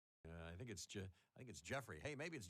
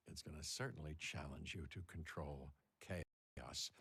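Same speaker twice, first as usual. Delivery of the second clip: low dramatic correction; 7 semitones lower than usual. Shown as a demonstration. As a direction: land it low like a trailer.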